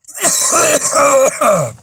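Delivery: loud, harsh smoker's cough; chesty cough. Real, not acted. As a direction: bark out a heavy cough. Caller keeps coughing, smoker.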